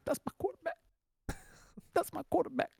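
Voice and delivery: high, teary mock sob of a player; a wailing, choked-up parody of a crying athlete; imitating crying. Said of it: "He was crying. He was going".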